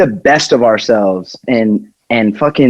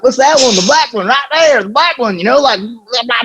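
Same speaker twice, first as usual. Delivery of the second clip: dim rally guy; 11 semitones higher than usual. Retold or imitated. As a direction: point at the shirt like a clueless customer. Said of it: "And he was like".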